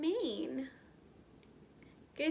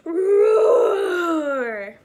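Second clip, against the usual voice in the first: huge shouted lion roar, much higher and louder; 5 semitones higher, 15 dB louder than usual. Acted. Lion teaching the child to roar, full roar. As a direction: roar as loud as you can into the mic. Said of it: "you roar as loud as you can".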